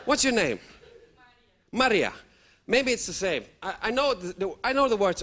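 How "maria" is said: usual speaking voice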